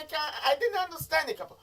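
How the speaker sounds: high put-on voice of a confused listener; mock-confused listener calling out to Capo. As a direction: play the puzzled fan who can't follow. Mimics confused listener complaining.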